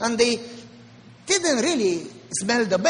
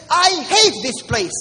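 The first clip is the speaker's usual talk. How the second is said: angry outburst; 5 semitones higher, 5 dB louder than usual. Retold or imitated. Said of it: "I got so angry that I said".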